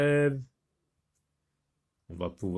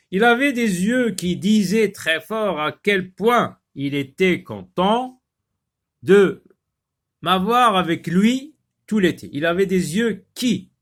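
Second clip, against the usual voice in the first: louder, higher expressive read of the example; reading aloud; 8 semitones higher, 8 dB louder than usual. Acted. Teacher reading an example sentence to pupils, expressive read.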